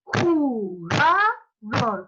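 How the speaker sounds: "Jugador" very slow, beat by beat; syllable beats. Shown as a demonstration. Teacher to class, counting syllables aloud.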